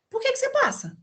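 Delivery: mock-incredulous question jumping high; high teasing "por que"; sudden high questioning. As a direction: pitch it way up in disbelief. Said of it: "Eu falo".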